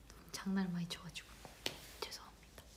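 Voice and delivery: sheepish whispered apology, barely audible; hushed, embarrassed whisper. Real, not acted. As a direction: whisper it as if afraid of getting scolded. Whispered apology after a gaffe.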